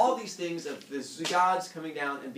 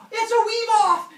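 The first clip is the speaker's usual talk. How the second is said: huge excited yell; crowd yelling; 14 dB louder than usual. Retold or imitated. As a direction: scream it like a hyped crowd.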